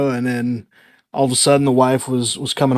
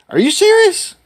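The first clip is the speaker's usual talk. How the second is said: high-pitched, louder disbelieving question; incredulous; 5 dB louder than usual. Retold or imitated. Husband to crying wife on the pregnancy news, incredulous. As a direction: act out stunned disbelief, pitch leaping up.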